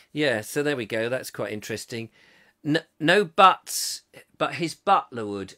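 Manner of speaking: normal talking voice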